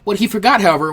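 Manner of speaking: plain reading voice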